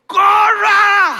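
high, full-volume acted scream; frantic shout. Husband seeing their boat pass, all-out acted shout. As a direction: scream the order as the boat gets away.

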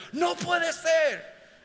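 high, drawn-out cry of disbelief; astonished disbelief. Acted. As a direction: cry out high, slowly, as if refusing to believe it. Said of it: "es que tú no lo crees". (grito de incredulidad asombrada)

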